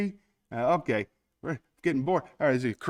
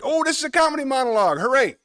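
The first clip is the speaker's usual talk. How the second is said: bright, fast, excited; 12 semitones higher, 7 dB louder, faster than usual. Real, not acted. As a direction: announce it with glee. Excited about comedy monologue.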